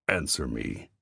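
a major ordering the private; deep commanding growl. Acted. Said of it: "the deep, commanding voice growled".

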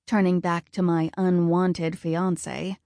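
usual speaking voice

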